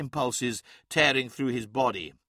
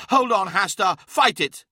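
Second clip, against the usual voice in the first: urgent shouting; 7 semitones higher, 7 dB louder than usual. Acted. Urging a seizing comrade.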